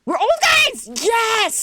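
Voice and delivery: high, delighted cry of joy; thrilled shout. Real, not acted. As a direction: squeal it out with delight. Thrilled discovering old characters.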